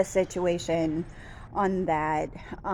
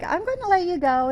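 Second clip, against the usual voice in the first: flustered sign-off; 11 semitones higher, 4 dB louder, faster than usual. Retold or imitated. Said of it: "And so I was like".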